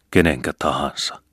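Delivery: usual speaking voice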